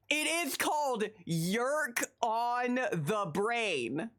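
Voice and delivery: slow, deliberate emphasis on each word; emphatic spelling-out. Real, not acted. Streamer insisting on the toy's name, slow and emphatic. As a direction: stretch it out to make the point.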